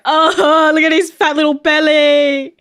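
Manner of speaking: overwhelmed by cuteness. Real, not acted. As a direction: squeal over the fat belly. Gushing at puppy video.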